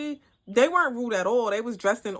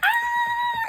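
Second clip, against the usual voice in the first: excited scream. Retold or imitated. Idol greeting her fan with a high excited shriek. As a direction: squeal with joy at meeting.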